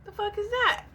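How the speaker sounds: high incredulous question; confused and caught off guard; loud high astonished question; baffled disbelief. Retold or imitated. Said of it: "I'm like".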